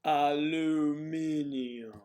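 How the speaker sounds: "Aluminium" very slow and loud; exaggerated, long-held "Aluminium"; drawn-out pronunciation. Shown as a demonstration. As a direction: stretch every syllable of "Aluminium", loudly. Exaggerated demo of the disputed word.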